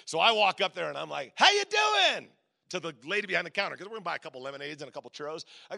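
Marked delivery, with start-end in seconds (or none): 1.3-2.3 chirpy greeting. Retold or imitated. Cheerful greeting to the cashier.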